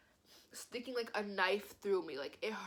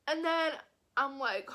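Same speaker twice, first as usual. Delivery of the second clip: ordinary talk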